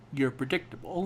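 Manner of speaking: softly voiced, no anger at all; a calm narrator voice instead of a threat; mild Liev Schreiber imitation. Mimicking Liev Schreiber's mild villain line, calm and soft. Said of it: "Liev Schreiber has no angry voice".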